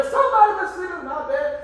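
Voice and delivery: high indignant bear mother; Mama Bear voice. Acted. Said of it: "Mama bear".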